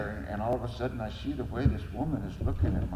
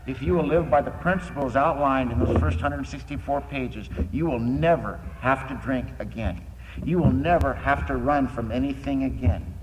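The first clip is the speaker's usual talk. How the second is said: preaching; 3 semitones higher, 7 dB louder than usual. Acted. Speaker preaching the program to the meeting, loud. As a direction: drive the message home to the crowd.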